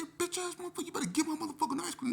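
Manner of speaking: very high, strained rant; outraged demand. Retold or imitated. Youth demanding his ice cream from staffer, outraged. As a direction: rush out the curse in a squeal.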